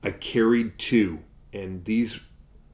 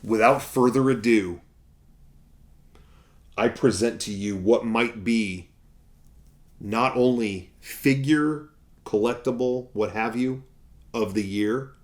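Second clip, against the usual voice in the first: loud, showman unveiling; mock grand announcer unveiling the collectible; grand presentation; 3 semitones higher, 4 dB louder than usual. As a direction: present it like a prize.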